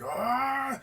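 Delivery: high, drawn-out mock yell; imitated angry cry. Kicked man getting mad on the platform, mock outraged cry. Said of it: "he was just like".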